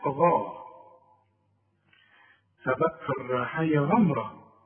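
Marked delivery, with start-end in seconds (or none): 0.1-0.7 غاء drawn out with a thick gh; heavy ghayn. Shown as a demonstration. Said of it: "كل غين في القرآن". (غاء بغين مفخمة)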